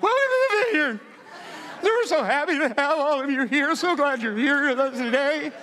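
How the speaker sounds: very high, loud panicky welcome; hammed-up nervous falsetto; mock terrified greeting. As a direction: squeal a cheery welcome through sheer nerves. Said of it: "my hands are shaking".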